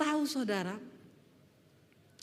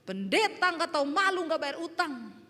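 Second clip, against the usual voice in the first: loud, higher-pitched yell of accusation; debt collector's shout; 5 semitones higher, 3 dB louder than usual. Retold or imitated. Debt collector shouting at the indebted pastor at home. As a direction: imitate a collector hollering at a debtor. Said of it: "teriakin saya".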